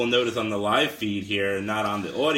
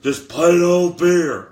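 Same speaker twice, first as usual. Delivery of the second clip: loud, slow, raised promo; hollered wrestler impression; Stone Cold Steve Austin; 6 semitones higher, 9 dB louder, slower than usual. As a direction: declare it slowly to a roaring crowd. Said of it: "this is your boy, Stone Cold Steve Austin".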